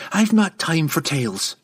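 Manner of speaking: high, impatient brush-off; curt. A narrator's reading. Young woman refusing to explain to the lady, curt. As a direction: cut the talk short firmly. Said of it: "she said curtly to Illustrial".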